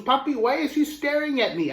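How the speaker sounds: put-on a boy unsure why he's watched; nervous little boy. Little boy asks his papa why the wrestler stares, high and worried. Child voiced in story.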